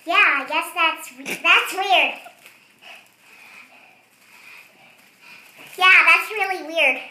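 helium voice. Real, not acted. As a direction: talk after a breath of helium. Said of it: "I am gonna do the weird talking helium voice thing".

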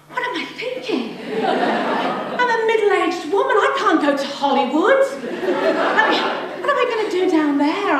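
retold panic, voice climbing; mild panic. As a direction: fret aloud in a lightly acted panic. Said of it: "I was panicking".

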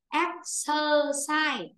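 slow "Exercise", stressed on the opening e; clear stressed e. Shown as a demonstration. Teacher reminds the class, slow "Exercise" with a stressed first e. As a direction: draw out "Exercise", EX-uh-sai. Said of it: "từ đây ta phải đọc ra âm E".

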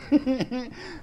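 high-pitched tittering giggle; giggling laugh. Real, not acted. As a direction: giggle away at your own joke. Laughs after his bang.

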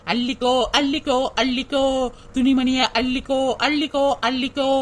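lightly imitated hawker's sing-song call; street announcer call. Wife mimics the sale announcement to her husband, hawker call. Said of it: "அப்படின்னு announcement பண்ணிட்டு போனாங்க".

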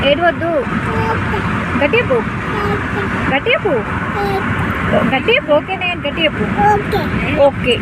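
high, loud sing-song coaxing. Real, not acted. Coaxing child to greet viewers. (పిల్లకు నేర్పిస్తూ ముద్దుగా చెప్పడం)

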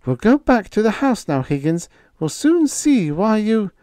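calmly angry. A narrator's reading. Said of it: "She was calmly angry".

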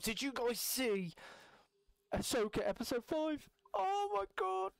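gasping with excitement, pitch soaring; high, giddy Star Wars fan squeal; excited. Real, not acted. Ahsoka excitement.

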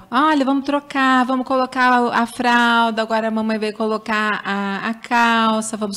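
put-on high singsong voice, cooing at a baby; mother talking to baby. Mom narrating a diaper change to her baby, high singsong coo.

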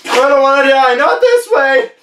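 loud, very high cry of terror; panicked wail. Acted. Balloon blower fears it will explode, high hammed-up terror. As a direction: plead for your life, rising into a squeal.

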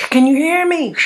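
high and loud, as if from a faraway radio; distant yelling voice. Retold or imitated. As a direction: call out faintly from far away through static. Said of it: "and it was far".

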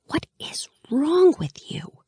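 sharp angry whisper, slow; furious whisper. A narrator's reading. Alina whispering furiously at a cheerful Mal. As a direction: hiss it at him furiously under your breath. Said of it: "I whispered furiously".

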